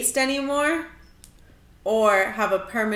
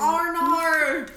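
put-on New Zealand accent; 6 semitones higher, 4 dB louder than usual. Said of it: "Rate my New Zealand accent".

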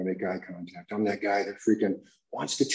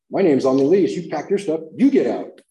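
louder and higher, arguing back; defiant argument; 6 semitones higher, 8 dB louder than usual. Retold or imitated. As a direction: throw her words back at her. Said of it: "And I said".

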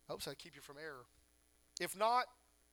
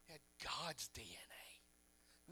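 whispered slowly, low and soft; stage whisper; 7 semitones lower, 17 dB quieter, slower than usual. Real, not acted. Preacher whispering a revelation to the congregation. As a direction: breathe it like a secret.

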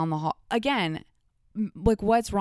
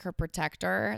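ordinary talk, nothing marked

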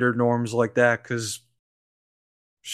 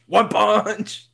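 higher, playful shout; excited exclamation; 8 semitones higher than usual. Pretend. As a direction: ham it up with glee. Repeating friend's line, then shouting.

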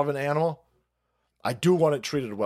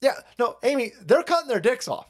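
ordinary talk, nothing marked

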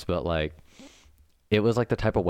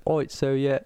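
a coworker's British accent, done badly; 7 semitones higher than usual. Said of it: "excuse my horrible British accent".

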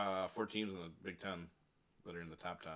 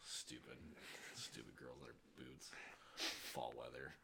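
grumbling; 5 semitones lower, 7 dB quieter than usual. Real, not acted. Grumbling about the girls.

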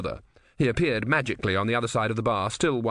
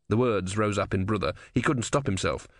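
usual speaking voice

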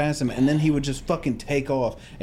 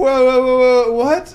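loud, high, rapid-fire disbelief; acted startled protest; 9 semitones higher, 8 dB louder, slower than usual. Son answering dad's angry phone call, acted bewilderment. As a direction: break in with mock alarm.

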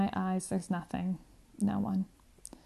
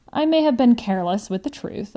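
ordinary delivery, nothing marked

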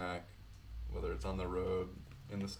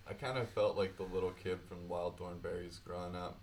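usual speaking voice

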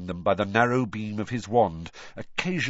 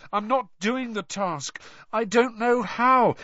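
higher, drawn-out admission of defeat; mild high-pitched despair; hopeless; 10 semitones higher than usual. A narrator's reading. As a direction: give up helplessly. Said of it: "Harry said hopelessly".

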